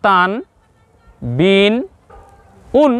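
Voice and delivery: "তান, বিন, উন" one by one, each closed on n; pronunciation demo. Shown as a demonstration. Teacher recaps three letters with tanween, pronunciation demo. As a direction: model "তান, বিন, উন" as separate, held syllables. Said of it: "তাহলে আমরা পেলাম কি?".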